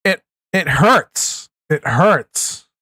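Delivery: slow, pained and let down; hurt. Real, not acted. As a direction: say it like it truly hurts. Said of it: "because I thought Kash was honest".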